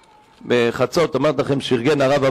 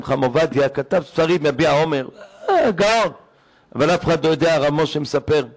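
normal talking voice

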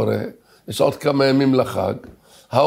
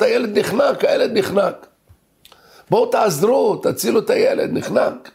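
panicked screaming; 8 semitones higher than usual. Retold or imitated. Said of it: "צעקות, צעקות".

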